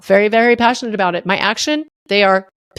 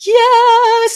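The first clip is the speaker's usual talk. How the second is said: much higher and louder, a bright heavenly cry; angels chorus; 13 semitones higher, 5 dB louder than usual. Retold or imitated. Angels cheering down from heaven, high and loud. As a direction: sing out like angels descending. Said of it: "angels would come down and be like".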